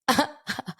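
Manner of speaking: a high burst of mock laughter; imitated hysterical laugh. Host retelling how the co-host laughed at her question, high mock laugh. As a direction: mimic him cracking up at the question. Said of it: "He starts laughing hysterically".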